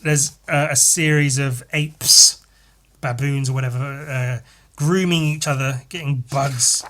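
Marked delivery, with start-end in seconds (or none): none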